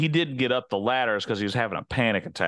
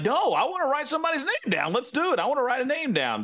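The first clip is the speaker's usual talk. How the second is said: high, eager insisting; high-pitched eager plea; eager protest; 11 semitones higher than usual. Retold or imitated. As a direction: beg to get to vote. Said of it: "I'd be like".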